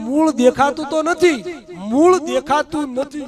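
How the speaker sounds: a son insisting he could not see them; son protesting. Acted. Son protests the roots can't be seen. (દીકરાનો મોટેથી, ઊંચા સ્વરે વિરોધ)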